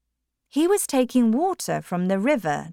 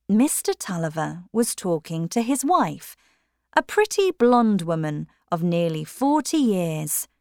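plain reading voice

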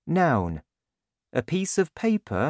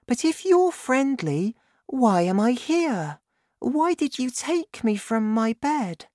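put-on much higher girl's voice, puzzled; high worried child; little girl Sophie; 11 semitones higher than usual. A narrator's reading. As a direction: ask like a worried small girl. Sophie questions giant.

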